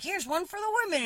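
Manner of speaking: high, mocking imitation of the old guy; mock old timer. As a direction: lightly parody his smug dedication. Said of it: "he'd be like".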